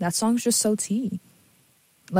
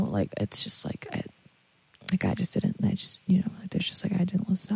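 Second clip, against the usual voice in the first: whispering; 7 dB quieter than usual. Real, not acted. Embarrassed aside, trailing off.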